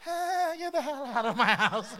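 loud, high, laughing mock anger; mock grumpy outburst. Sleepy host yelling at early visitor, mock grumpy. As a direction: shoo them out with a laugh. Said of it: "I'm like".

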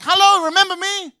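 high, loud mock call; a big falsetto hello; hammed-up high loud greeting; Jesus calling out. Jesus voiced in sermon.

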